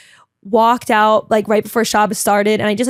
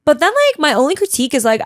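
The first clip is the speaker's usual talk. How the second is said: ordinary talk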